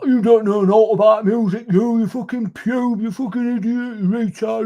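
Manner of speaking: very high, whiny mocking voice; mock abusive punter. Punters abusing the DJ over music, high mock whine. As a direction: squeal the insults in a hammed-up imitation.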